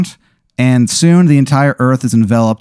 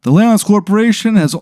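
pitched-up, solemn plea of a fading holiday spirit; weary Father's Day spirit; 9 semitones higher than usual. Acted. Game master voicing the Father's Day spirit to Aaron, high and solemn. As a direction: sound like a father figure losing his powers.

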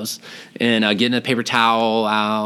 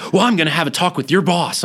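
threatening daughter; 7 semitones higher, 3 dB louder than usual. Retold or imitated. Daughter threatens in retold argument.

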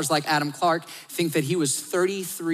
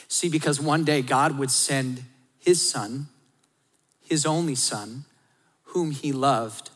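ordinary delivery

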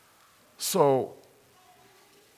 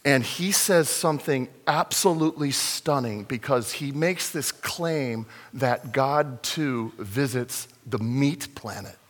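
usual speaking voice

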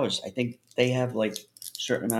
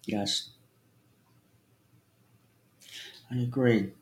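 sucking a lollipop; 3 dB quieter than usual. Real, not acted. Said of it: "It's my lollipop".

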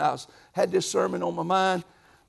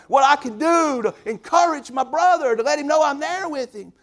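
rising preaching; 9 semitones higher, 8 dB louder than usual. Real, not acted. Preaching climax.

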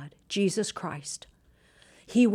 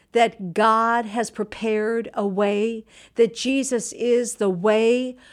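slow, raised preaching voice; 3 semitones higher, 4 dB louder, slower than usual. Acted. Preacher urging viewers to walk with God, slow and raised.

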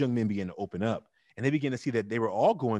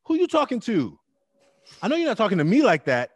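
put-on much higher and louder, squaring up to a yelling kid; angry loud retort; 10 semitones higher, 7 dB louder than usual. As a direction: hit back hard, who do you think you're talking to. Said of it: "I'm like".

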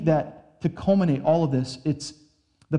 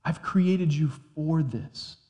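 voice of God; 3 dB quieter, slower than usual. Retold or imitated. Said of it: "and he's said to us".

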